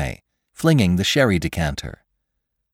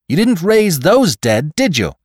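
urgent challenge; 11 semitones higher, 6 dB louder than usual. Acted. Victim challenges his attacker mid-struggle, urgent. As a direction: press the point, pitched right up.

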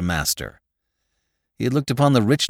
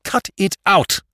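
high, hard, each word separated; savage; 10 semitones higher, 4 dB louder than usual. A narrator's reading. Jimmy repeating his order to Spike, savagely. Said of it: "said Jimmy savagely".

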